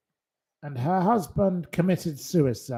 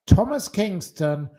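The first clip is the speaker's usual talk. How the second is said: ordinary talk, nothing marked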